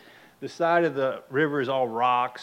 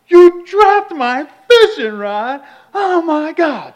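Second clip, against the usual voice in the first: slow, high, full-volume tantrum yell; retold meltdown; 17 dB louder, slower than usual. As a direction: bellow in outraged disbelief. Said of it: "I lose my mind".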